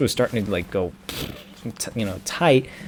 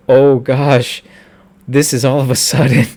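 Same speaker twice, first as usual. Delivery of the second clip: taken aback; 2 semitones higher, 7 dB louder than usual. Retold or imitated. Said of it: "I was taken aback".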